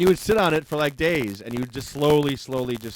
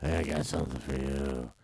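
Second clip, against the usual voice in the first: much lower, soft creepy come-on; deeper creepy voice; 10 semitones lower, 6 dB quieter than usual. Pretend. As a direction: go deep and sleazy. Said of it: "You gotta go a little deeper".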